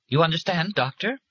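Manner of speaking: high, pointed challenge; pointed question. A narrator's reading. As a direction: press him for an answer. Pressing threat.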